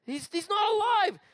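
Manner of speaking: very high, stammering mock panic; freaked-out pastor. Pastor to the healer over the fallen person, freaked out. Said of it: "The pastor was freaked out".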